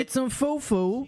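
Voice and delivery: put-on high, cutesy baby talk; mock baby voice.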